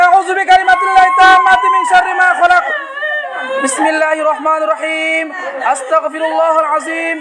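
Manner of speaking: sustained chant on a level tone; Quranic healing recitation. Real, not acted. Healer recites over the patient. (ঝাড়ফুঁকের সুরে দোয়া পাঠ)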